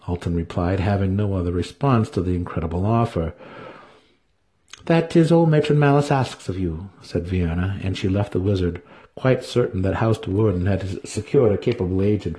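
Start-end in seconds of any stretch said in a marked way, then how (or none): 4.8-6.9 commanding priestess. A narrator's reading. Priestess instructing the wizard, high and commanding. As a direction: give the order from above. Vienna voiced.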